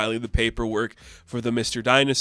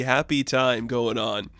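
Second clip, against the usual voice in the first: normal talking voice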